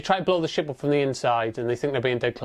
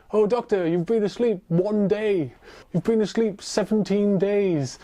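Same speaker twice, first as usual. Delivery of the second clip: mock doctor exposition; 4 semitones higher than usual. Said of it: "you go like".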